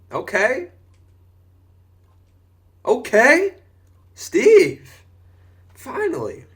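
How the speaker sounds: high, loud building cheers; rising hype at the play; excited. Real, not acted. Player finally makes a play.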